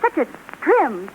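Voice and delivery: ordinary talk, nothing marked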